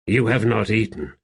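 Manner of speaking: usual speaking voice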